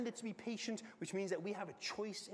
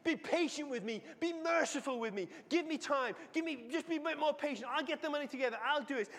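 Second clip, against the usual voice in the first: loud, high desperate begging before a king; pleading servant; 7 semitones higher, 5 dB louder than usual. Acted. Servant on his knees begging the king, loud and high. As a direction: throw yourself at his feet and beg aloud.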